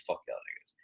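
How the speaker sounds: very low, soft retold kiss-off; hushed, dropped-pitch dismissal under the breath; dismissive mutter. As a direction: grumble it quietly to yourself. Retells slipping off to his office.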